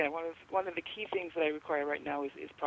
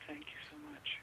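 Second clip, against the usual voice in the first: soft breathy whisper thanks; 11 semitones higher, 7 dB quieter than usual. Real, not acted. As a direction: whisper it gratefully.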